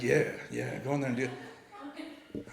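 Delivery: soft, low, slow coaxing; a sly little voice in his head; coaxing inner voice. Retold or imitated. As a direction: egg him on under your breath. Brain voice coaxing him.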